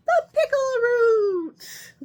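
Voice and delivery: high, loud, slow cry of triumph; triumphant cry. Acted. Witch finding the lost root at last, crying out loud and high. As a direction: shout out the find with joy.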